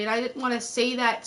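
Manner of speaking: ordinary talk, nothing marked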